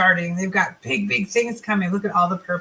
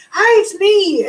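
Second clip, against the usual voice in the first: high, loud excited hello; a bubbly customer greeting her, high and loud; 10 semitones higher, 5 dB louder, slower than usual. Retold or imitated. A customer bursts in with a high, loud excited hi. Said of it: "she was all excited and she's like".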